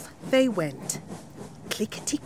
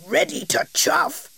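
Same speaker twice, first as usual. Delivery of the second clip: king asks; 7 semitones higher than usual. Acted. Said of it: "he said".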